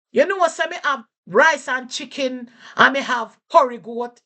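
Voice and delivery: high, loud Jamaican patois answer; a shopkeeper listing dishes in patois. Shown as a demonstration. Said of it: "Person might say".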